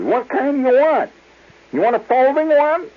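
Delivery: high, loud put-on saleswoman; impatient clerk. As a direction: hammer the questions up high. Clerk presses the customer.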